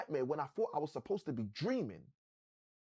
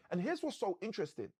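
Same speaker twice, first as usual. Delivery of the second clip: ordinary talk, nothing marked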